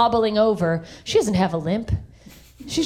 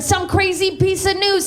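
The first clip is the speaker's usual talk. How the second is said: ordinary delivery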